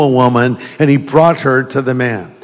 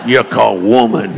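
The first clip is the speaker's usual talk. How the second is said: hammed-up Southern accent, trailing lower; 3 semitones lower than usual. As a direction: play it as a Southerner. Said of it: "like he was from the South".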